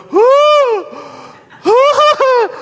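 very high, loud falsetto whoops; imitating a friend's high crying whoop; high-pitched whoo. Pastor imitating friend's high wail at the altar, mock falsetto. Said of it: "real high like that".

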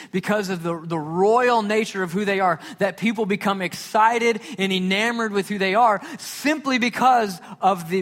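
loud, high, driving sermon voice; full-force preaching. Acted. Pastor preaching to the congregation about God's throne, loud and rising.

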